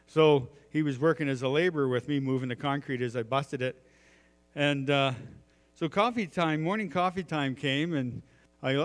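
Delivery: ordinary delivery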